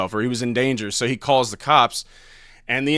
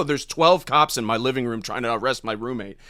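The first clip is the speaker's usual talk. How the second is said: ordinary delivery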